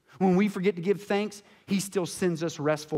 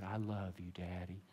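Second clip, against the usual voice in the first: put-on very soft, tender whisper of a little boy; tender child's thanks; 10 semitones lower, 13 dB quieter than usual. Boy rescued by his father, soft and tender. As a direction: murmur it gently, clinging on. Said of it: "He said".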